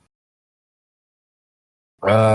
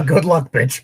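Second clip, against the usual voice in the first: laughing taunt; 5 semitones higher, 3 dB louder than usual. Real, not acted.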